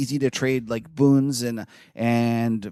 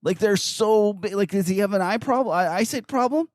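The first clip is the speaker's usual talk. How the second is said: incredulous mockery; 8 semitones higher than usual. Real, not acted. Host teasing an analyst's giant crayon charts, incredulous. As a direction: tease the huge charts in mock disbelief. Mocking a guest's huge charts.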